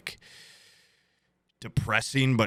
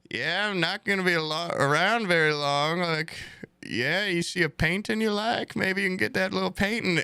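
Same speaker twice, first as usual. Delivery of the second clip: frail grandma; 7 semitones higher than usual. Retold or imitated. Grandson imitates grandma moaning about dialysis, high and frail. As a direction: mimic a frail, complaining grandma. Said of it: "she's like".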